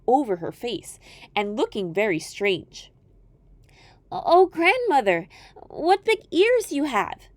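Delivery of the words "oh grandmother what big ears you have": put-on high, slow, wide-eyed girl voice; a child amazed at grandmother; wondering little girl.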